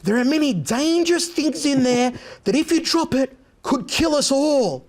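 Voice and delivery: mock-grave warning about a dangerous bag, drawn out high; Steven Seagal impression. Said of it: "Stephen's like".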